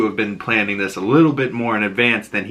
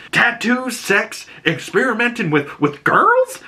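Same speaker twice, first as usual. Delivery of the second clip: high mock exasperated list; exasperated dad; 13 semitones higher than usual. Joel scolding Ellie, high exasperated rant. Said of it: "he's like".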